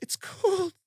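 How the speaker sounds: high, drawn-out mock wail of Jack; Titanic Rose calling Jack. Wheeled away on the gurney, calling to his roommate in a high mock-Titanic wail. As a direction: play the heartbroken heroine, way up high. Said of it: "like, Titanic hands".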